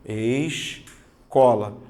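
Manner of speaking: escola said slowly with the s and c both heard; teacher repeating escola slowly; slow careful escola. Shown as a demonstration. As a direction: draw out escola evenly, falling at the end. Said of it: "Eu estou notando os dois sons".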